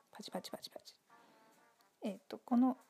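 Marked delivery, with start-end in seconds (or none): 0.1-0.9 very soft mock clapping in words; hushed, voiceless pretend clapping; whispered applause. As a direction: whisper a little round of applause. Mimes applause after the scene note.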